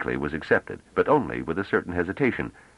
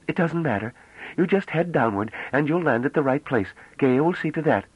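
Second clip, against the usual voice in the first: put-on high, bright-eyed girlish voice, full of wonder; young woman Bliss, eager; 11 semitones higher than usual. A narrator's reading. Young woman marvelling at the ship, high and excited.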